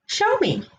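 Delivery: put-on child pleading to be shown; eager child. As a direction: beg like an impatient kid. Girl begs Joe.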